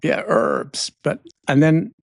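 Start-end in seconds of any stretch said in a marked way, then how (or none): 0.0-0.9 muttered agreement. Retold or imitated. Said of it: "And I was like".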